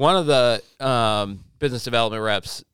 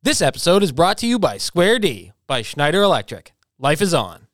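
upbeat louder ad read; sponsor ad read; 6 semitones higher, 7 dB louder than usual. Acted.

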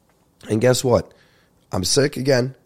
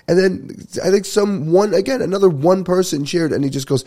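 ordinary delivery

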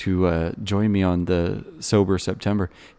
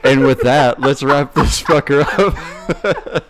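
drawn-out, loud, laughing wrap-up; slow dramatic sign-off; 6 semitones higher, 7 dB louder, much slower than usual. Acted. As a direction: stretch it out like a big finale. Dramatic sign-off after chef jokes.

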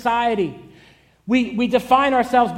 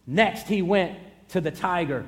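usual speaking voice

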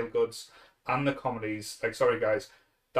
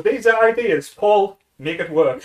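loud, higher bossy instruction; bossy order; 7 semitones higher, 8 dB louder than usual. Retold or imitated. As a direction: hand it off bossily. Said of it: "Sam goes".

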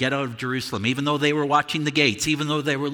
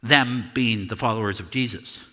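ordinary talk, nothing marked

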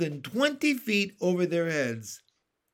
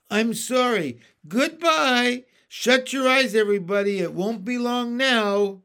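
high, loud tearful farewell; 5 semitones higher, 6 dB louder than usual. Acted. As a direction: call out goodbye to friends. James's farewell as peach falls.

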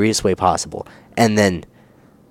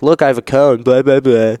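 louder mock of the guy; mock attention seeker; 4 semitones higher, 4 dB louder than usual. Mocking the guy showing off his cone, louder. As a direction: parody the show-off.

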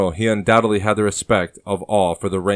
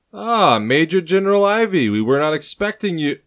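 surprised harbor official; 10 semitones higher, 4 dB louder than usual. Acted.

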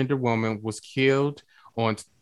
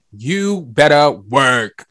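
drag queen catchphrase; 7 dB louder, much slower than usual. Retold or imitated.

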